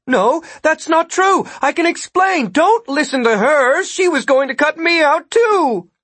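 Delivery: high, loud, frantic protest; blurting out. A narrator's reading. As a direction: blurt out a distress call at high pitch. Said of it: "basically blurting out".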